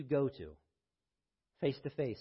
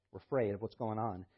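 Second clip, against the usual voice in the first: normal talking voice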